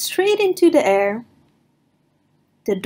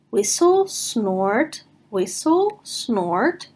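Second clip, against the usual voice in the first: whistle snort noises; much slower than usual. Acted. Narrator imitates rock noises.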